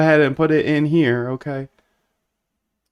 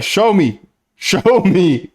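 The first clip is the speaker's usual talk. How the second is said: higher, louder mock voice of her demanding; playful mock demand; 6 semitones higher, 5 dB louder, much slower than usual. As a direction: beg to see it with a laugh. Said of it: "She's like".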